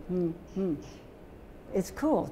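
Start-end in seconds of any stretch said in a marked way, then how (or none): 0.1-0.7 two closed-mouth hums; duck talk hum. Shown as a demonstration. Teacher showing giraffe in Duck Talk, two closed-mouth hums. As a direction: hum a two-syllable word with lips sealed. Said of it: "But giraffe would be".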